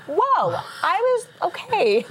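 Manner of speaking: high, startled whoa, then a sheepish okay; a retold gasp of surprise, pitched high; stunned whoa. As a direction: caught off guard, voice jumping up. Reacting to God's answer.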